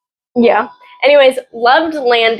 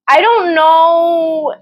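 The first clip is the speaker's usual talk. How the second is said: drawn-out musing; 4 semitones higher, much slower than usual. Real, not acted. Musing about Bakugo.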